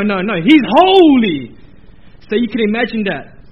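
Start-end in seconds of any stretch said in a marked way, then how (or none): 0.4-1.6 loud, slow, "holy" hammered; booming awe. Shown as a demonstration. Preacher building emphasis, shouts "He's holy" slowly. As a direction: proclaim "holy" like thunder. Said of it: "No, no, no".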